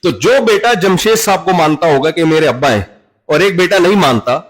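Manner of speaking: ordinary delivery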